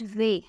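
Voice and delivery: "way" starting with a v sound; German letter W. Shown as a demonstration. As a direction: say "way" as German "veh". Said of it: "it's pronounced as way".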